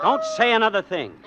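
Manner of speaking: high, loud, urgent order; urgent shout. Acted. Husband stopping his wife before the gunman snaps, urgent. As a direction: cut her off fast.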